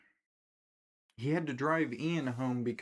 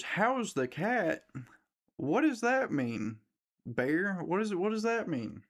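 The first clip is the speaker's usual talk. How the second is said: puzzled; 7 semitones higher than usual. Retold or imitated. Mimicking her as she reads the note, baffled and high. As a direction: drag out "How's" in high confusion.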